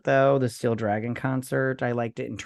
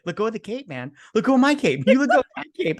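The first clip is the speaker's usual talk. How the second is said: high-pitched, escalating imitation of a fight; hammed-up indignant yelling; mock angry demand; 8 semitones higher, 4 dB louder, faster than usual. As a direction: act out a squabble, getting higher and louder. Acting out band fight quote.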